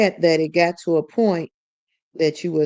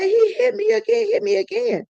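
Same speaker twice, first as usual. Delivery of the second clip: distraught cry. Retold or imitated. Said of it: "she was hollering and screaming and crying".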